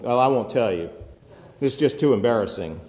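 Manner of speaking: ordinary talk, nothing marked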